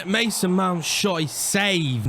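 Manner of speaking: ordinary talk, nothing marked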